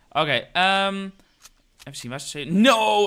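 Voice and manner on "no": alarmed shout. Real, not acted. Sudden setback in game.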